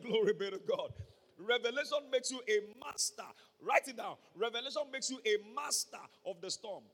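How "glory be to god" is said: louder repeat. Real, not acted. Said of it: "I said".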